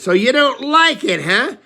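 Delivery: high, loud accusing snap; sarcastic wife. Retold or imitated. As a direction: hurl it at them like a challenge. Wife picking a fight, voiced.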